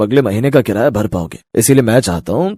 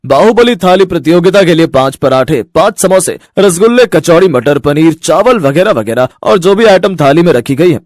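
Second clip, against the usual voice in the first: contest announcer; 3 semitones higher than usual. Acted. Restaurant owner opening the eating contest, booming announcer. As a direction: hype up the crowd like an MC.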